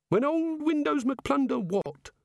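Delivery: high, slow puzzled god voice; the god asking, drawn out and high. Acted. The god asks.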